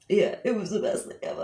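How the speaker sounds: ordinary talk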